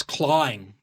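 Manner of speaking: CLAWING as "claw-wing", drawn out; slow CLAWING with open vowel and W glide. Shown as a demonstration. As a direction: stretch CLAWING with no R in it. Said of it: "That's how he said it".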